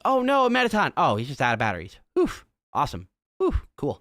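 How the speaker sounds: Alphys shrieks, then shrugs it off; panicked then relieved Alphys. Retold or imitated. Voicing Alphys rushing in.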